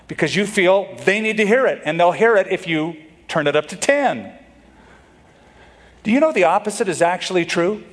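normal talking voice

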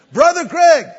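high, loud call across the campus; yelled greeting. Retold or imitated. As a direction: shout his name from far away. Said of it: "and he yelled out".

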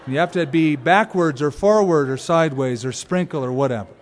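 usual speaking voice

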